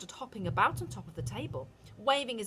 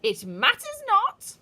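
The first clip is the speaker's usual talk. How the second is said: high, very loud, slow witch; shouting witch; 14 dB louder, slower than usual. A narrator's reading. Witch shouting to the audience, high and slow. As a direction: dismiss it with a shout. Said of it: "shouted the Grand High Witch".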